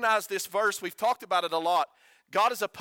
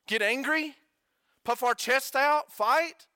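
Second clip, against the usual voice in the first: rising, louder mocking questions; mock incredulous questions; 7 semitones higher, 4 dB louder, slower than usual. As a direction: needle them with escalating mock questions. Mock-asking the wrong reaction.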